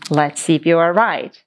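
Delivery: usual speaking voice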